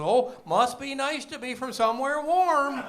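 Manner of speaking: put-on high, loud North Dakota girl, slowed; mock North Dakota accent, wide-eyed; hammed-up North Dakota local. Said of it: "She goes".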